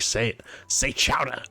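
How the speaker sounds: put-on demanding Jersey-accented correction of "chowder". Quimby's nephew pressing the French guy, insistent Jersey accent. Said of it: "Quimby's nephew's like".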